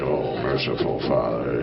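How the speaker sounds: very low, slow groan of a prayer; father flopping into bed drunk. Retold or imitated. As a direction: sink into bed with a deep sigh.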